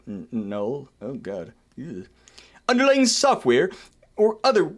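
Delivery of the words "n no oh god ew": much lower, softer groan; quiet horrified reaction; dismayed mutter. Real, not acted.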